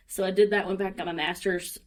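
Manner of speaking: ordinary talk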